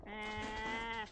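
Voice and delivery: normal talking voice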